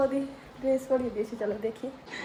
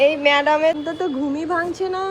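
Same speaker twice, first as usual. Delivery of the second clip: louder mock scolding; teasing call; 4 semitones higher, 6 dB louder than usual. Real, not acted. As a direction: rib her for sleeping in. Teasing the sleeping baby.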